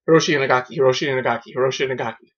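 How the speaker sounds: name rattled off rapidly; quick repeats run together; fast tongue-twister. Shown as a demonstration. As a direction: race through it like a tongue-twister. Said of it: "Say that three times fast".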